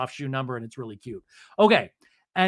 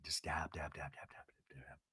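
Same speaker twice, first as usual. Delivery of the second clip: tiny whispered patter; hushed mock delicacy; fading whisper; 12 semitones lower, 21 dB quieter than usual. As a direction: repeat it softly, fading to nothing. Mimics delicate eye dabbing.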